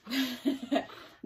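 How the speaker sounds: high, loud delighted laugh. Real, not acted. Teacher laughing as all the animals get bikes, delighted laugh.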